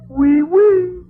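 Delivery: high, loud giddy squeal; loud, high and delighted yelp; excited squeal. Acted. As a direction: squeal it out, thrilled at the squeeze. Squeezed by the dancer.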